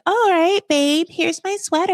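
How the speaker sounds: high-pitched mock girlfriend voice; accommodating girlfriend. Girlfriend offering her cold boyfriend a sweater, high mock-sweet. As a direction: go high and sing-song as the obliging girlfriend.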